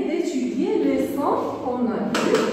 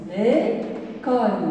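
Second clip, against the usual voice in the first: slow syllable reading; much slower than usual. Shown as a demonstration. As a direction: say "L'école" very slowly, syllable by syllable. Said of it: "une seule syllabe".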